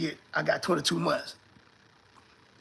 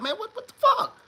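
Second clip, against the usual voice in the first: pitched way up in disbelief; shocked lawyer. Retold or imitated. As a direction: react like you can't believe what they did.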